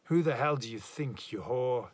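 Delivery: loud, bitter accusation from the doorway; jealous husband snarling at his wife; furious. Acted. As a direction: hurl the insult at her. Enraged husband bursts in.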